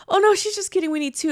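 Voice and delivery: high-pitched imitation of a pleading man; mock boyfriend voice. Boyfriend correcting her to lobby clerk, high mocking imitation. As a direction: pitch it up to mimic him.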